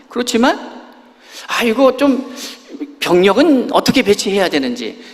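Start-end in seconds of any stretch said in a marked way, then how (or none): 1.5-5.0 lightly voiced Moses, higher and a bit louder; a hint of Moses weighing his options; imagined Moses reasoning. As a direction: talk it through like a cautious leader. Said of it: "하는 얘기로 들려요".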